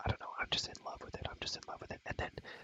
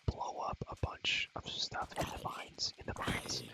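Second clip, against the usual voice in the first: normal talking voice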